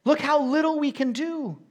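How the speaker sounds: loud, high cry; loud exclamation. Shown as a demonstration. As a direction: shout it out to the church. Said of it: "preacher contrasting man and God".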